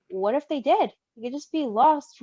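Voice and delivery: ordinary talk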